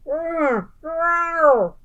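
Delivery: two grumpy growls; high grumbling growls. A narrator's reading. Bear muttering at the blessing, growling. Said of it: "muttered and growled".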